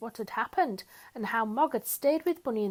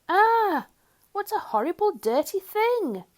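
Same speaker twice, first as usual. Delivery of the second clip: high, loud disgusted yell; 10 semitones higher, 7 dB louder than usual. A narrator's reading. Parents yelling at dirty Bunny, loud and disgusted. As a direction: shriek at the filthy toy. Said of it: "They yelled".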